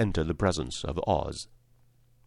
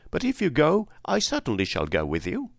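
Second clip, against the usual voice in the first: Tin Woodman; 8 semitones higher than usual. A narrator's reading. Tin Woodman promising to go along, high then settling. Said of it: "remarked the Tin Woodman".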